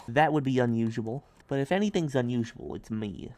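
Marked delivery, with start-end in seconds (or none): none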